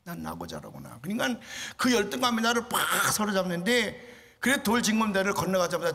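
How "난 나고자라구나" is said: ordinary talk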